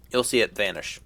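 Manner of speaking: ordinary talk, nothing marked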